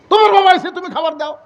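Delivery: high, loud sneering wife; mocking wife. Wife mocking father's arrival. (স্বামীকে ব্যঙ্গ করা বউয়ের উঁচু গলা)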